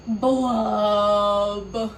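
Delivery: loud slow blub; fish blub. Shown as a demonstration. Reader leading children to blub along, loud. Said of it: "Say it with me".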